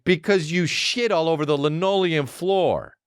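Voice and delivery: hammed-up kid explaining the obvious; exasperated commercial kid. Kid answers dumb dad, mock exasperated kid voice. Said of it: "And the kid's like".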